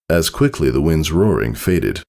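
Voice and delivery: plain reading voice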